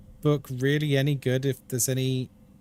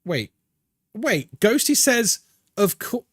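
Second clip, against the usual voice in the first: ordinary delivery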